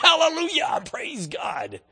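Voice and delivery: high, loud hammed-up cry of praise; mock hallelujah shout. Preacher mocking a congregation's praise, loud hammed-up shout. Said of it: "everybody stood up and went".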